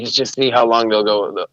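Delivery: ordinary talk, nothing marked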